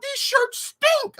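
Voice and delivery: high, slow mock complaint; mimicking Kevin. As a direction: drag it out in a squeaky boss voice. Kevin voiced in retold story.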